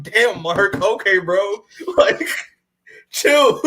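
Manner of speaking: laughing, high and lighthearted; playful laughing reprimand; mock telling off, laughing. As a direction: tease him through giggles. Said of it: "I'm like".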